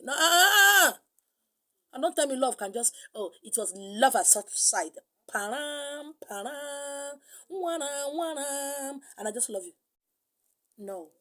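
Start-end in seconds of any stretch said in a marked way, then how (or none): 0.0-1.0 a big mocking no, stretched out; loud mocking no. Real, not acted. Loud refusal of the cliché. 3.1-9.7 slow, sing-song "param, param, wanna" in mockery; mock dreamy gush. Mocking love at first sight.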